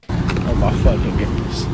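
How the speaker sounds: loud, slow exasperation; exasperated. Real, not acted. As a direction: stretch out the disbelief. Reacts to the clip.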